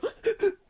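high hammed-up gasp; mock gasp. She mocks a pothead waking up, with a high hammed-up gasp. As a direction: gasp like a desperate stoner. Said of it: "I'm like".

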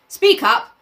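high, loud call in a read-aloud; shouted command. A narrator's reading. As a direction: call it out sharply as a character. Reads a boy's shouted command.

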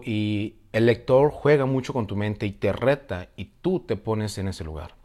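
ordinary delivery

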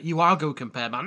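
usual speaking voice

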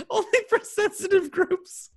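high squeaky laugh through words. Real, not acted. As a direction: giggle out the phrase. Mocking the phrase while laughing.

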